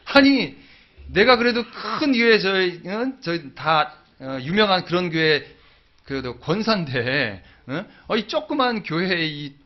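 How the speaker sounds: indignant outburst. Retold or imitated. Grandmother's offended outburst retold.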